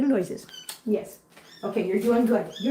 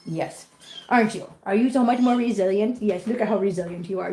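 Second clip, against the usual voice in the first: normal talking voice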